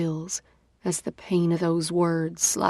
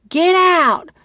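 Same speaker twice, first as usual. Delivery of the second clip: high, loud yell; 12 semitones higher, 8 dB louder than usual. Acted. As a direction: shout it at him. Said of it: "I yell".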